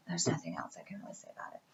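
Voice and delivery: soft unvoiced whisper, quick and throwaway; whispered aside. Real, not acted. Whispered aside while praising cards.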